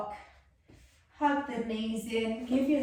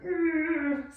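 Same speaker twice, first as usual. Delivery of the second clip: high, strained mock "Urgh" of effort; hammed-up effort groan; strained face groan; 6 semitones higher than usual. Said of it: "the more the face is gonna go".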